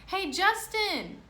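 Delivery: high, loud, slow call across the yard. Acted. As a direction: shout his name from afar. Calls out across playground.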